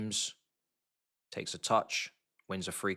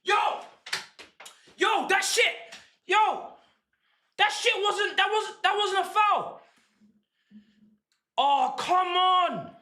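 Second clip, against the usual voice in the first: outraged protest; 8 semitones higher, slower than usual. Real, not acted. Protesting the foul call.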